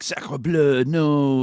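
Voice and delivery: high, loud French cry of dismay; hammed-up French exclamation; French accent. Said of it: "he was like".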